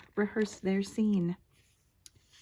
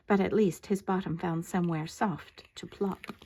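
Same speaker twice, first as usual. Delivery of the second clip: plain reading voice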